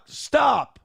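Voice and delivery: a loud, high "stop!"; exasperated shout. Real, not acted. Fed-up host yells at the cohost to stop, shouting. Annoyed host snaps at name mistake.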